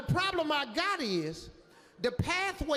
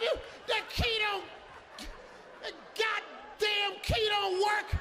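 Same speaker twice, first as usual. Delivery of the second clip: furious outburst; 9 semitones higher than usual. Retold or imitated. Guest yells at the staffer about the key, furious. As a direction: rage at the useless staffer.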